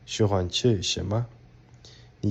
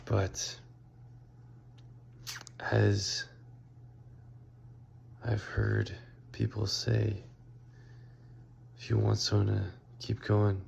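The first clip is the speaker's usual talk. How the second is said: quiet; 6 dB quieter than usual. Real, not acted. Late night tired recording.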